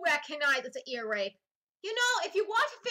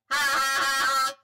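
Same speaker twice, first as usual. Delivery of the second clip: robot voice laugh; 4 semitones lower, 6 dB louder than usual. Shown as a demonstration. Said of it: "robot voice".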